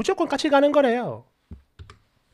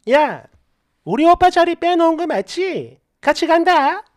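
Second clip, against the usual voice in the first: higher, louder, presumptuous friend; acted pushy friend, pitched up and loud; pushy announcement; 4 semitones higher, 5 dB louder than usual. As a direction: announce it as if already settled. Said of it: "아예 그냥 통보를 하더라고요".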